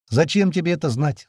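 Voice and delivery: usual speaking voice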